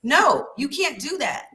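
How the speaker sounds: lightly voiced mom laying down the law; mama teacher voice. Teacher scolding her class in a mild mama voice. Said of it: "your mama voice comes out with your teacher voice".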